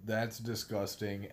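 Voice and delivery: mumbled. Retold or imitated. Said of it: "A J mumbled".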